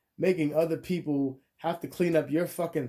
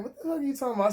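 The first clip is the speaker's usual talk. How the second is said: much higher disbelieving outcry; incredulous retort; 9 semitones higher, much faster than usual. Retold or imitated. Reacting to her sending a farther address, incredulous outcry. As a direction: voice jumping up in mock disbelief.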